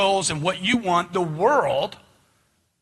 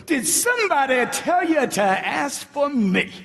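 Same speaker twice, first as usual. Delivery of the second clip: "somebody" shouted high, slow and eager; the coach shows the salesman the enthusiastic way to ask; 5 semitones higher, slower than usual. Shown as a demonstration. The coach shows the enthusiastic way to ask, high and eager. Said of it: "Enthusiasm. Where's your enthusiasm at?".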